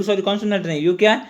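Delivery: ordinary talk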